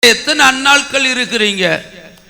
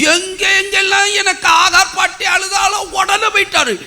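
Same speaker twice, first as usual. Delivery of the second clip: high-pitched, impassioned complaint; indignant exclamation; 7 semitones higher than usual. Real, not acted. Preacher complaining God ran to weeping Hagar, high exclamation. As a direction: protest it in a high, straining voice.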